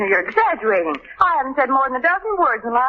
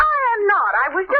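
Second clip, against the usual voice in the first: indignant; 9 semitones higher than usual. Acted. Indignant protest.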